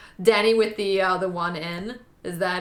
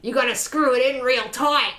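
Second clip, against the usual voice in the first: Australian accent; 3 semitones higher, 5 dB louder than usual. Shown as a demonstration. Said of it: "in Australia".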